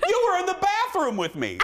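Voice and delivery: laughing through it; retold yell. Friend repeating her accusation in a high laughing shriek. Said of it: "She goes".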